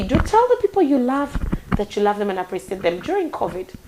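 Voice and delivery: normal talking voice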